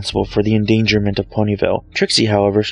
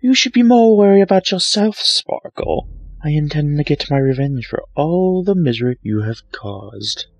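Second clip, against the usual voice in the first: menacing Trixie; 5 semitones higher than usual. Acted. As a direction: warn her rival coldly. Trixie threatens.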